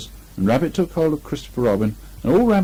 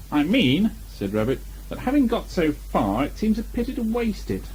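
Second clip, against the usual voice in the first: a hint of a fussy, higher Rabbit voice; lightly voiced Rabbit; 7 semitones higher, 3 dB quieter than usual. A narrator's reading. Rabbit reasoning with Christopher Robin, lightly voiced fussy. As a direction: Rabbit pressing his point, a touch higher.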